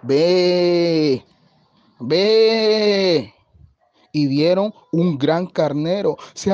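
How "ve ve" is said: long drawn-out bleat, louder than the narration; ram's bleat. A narrator's reading. Voice heard after much walking, bleating like a ram. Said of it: "una voz que decía".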